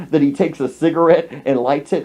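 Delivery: usual speaking voice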